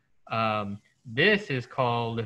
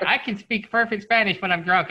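boastful; 7 semitones higher than usual. Retold or imitated. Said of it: "And I'm like".

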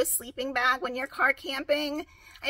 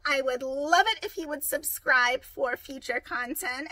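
ordinary talk, nothing marked

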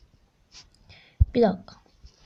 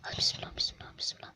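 hushed, breathy bismillah under the breath; soft anxious whisper for luck; whispered prayer; 15 dB quieter, faster than usual. Real, not acted. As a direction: murmur it like a nervous little prayer. Whispered prayer before opening.

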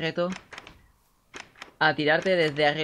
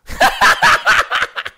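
loud burst of laughter; 11 dB louder than usual. Real, not acted. Failed the catch.

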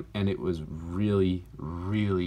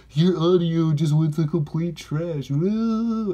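high, loud, jeering commenter voice; hammed-up heckling yell; mocking shout; 9 semitones higher, 7 dB louder, slower than usual.

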